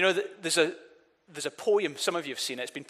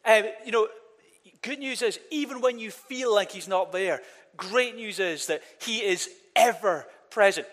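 usual speaking voice

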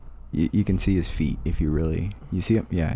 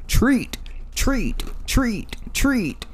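high robotic dog button voice; squeaky dog demanding treats; talking dog voice; much slower than usual. Retold or imitated. Dog pressing talk buttons.

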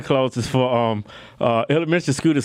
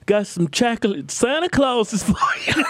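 put-on eager kid salesman; 8 semitones higher, slower than usual. Kid selling chocolate door to door.

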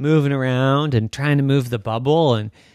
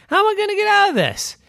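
fretting; 4 dB louder, faster than usual. Acted. Trapped girl frets.

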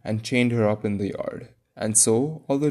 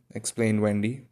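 ordinary delivery, nothing marked

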